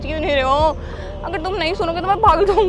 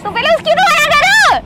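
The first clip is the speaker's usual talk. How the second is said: exasperated demand; 11 semitones higher, 9 dB louder than usual. Acted. Demanding he stop the engagement.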